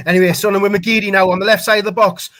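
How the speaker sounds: normal talking voice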